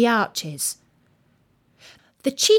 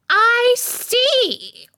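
very high, loud Queen's cry; imperious; 6 dB louder than usual. A narrator's reading. Queen examining the roses cuts in, loud and imperious. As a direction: cut in loudly and high, as the Queen.

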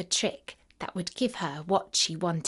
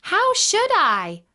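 high, loud, drawn-out question; high-voiced child asking indignantly; little girl's answer; 11 semitones higher, 6 dB louder, slower than usual. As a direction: protest as a young girl, pitched way up. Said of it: "answered Dorothy".

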